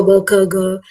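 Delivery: normal talking voice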